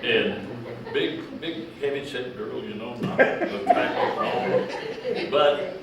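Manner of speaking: laughing through the description. Real, not acted. Amused old man laughing while describing her. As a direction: chuckle out the words, voice jumping high.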